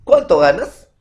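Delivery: loud direct question, GANAS rising. Shown as a demonstration. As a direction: ask "cuánto ganas" loudly, lifting the end. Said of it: "entre signo de interrogación".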